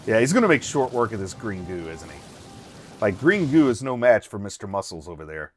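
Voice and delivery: ordinary talk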